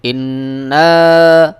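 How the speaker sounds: slow melodic tajweed chant; ধীর সুরেলা তিলাওয়াত; Quran recitation. Shown as a demonstration. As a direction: draw out every vowel in recitation. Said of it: "কিভাবে মুখস্থ করবেন সেভাবে দেখিয়ে দিই".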